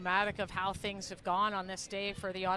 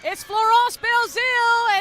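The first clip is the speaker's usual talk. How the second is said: shouting the goal, pitch way up; excited goal call; 10 semitones higher, 14 dB louder than usual. Real, not acted. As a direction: erupt with joy at the goal. Goal scored, commentator erupts.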